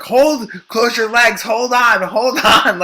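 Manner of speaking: high, urgent repeated command; urgent doctor. Retold or imitated. Doctor to woman giving birth, urgent. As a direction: imitate a doctor halting everything.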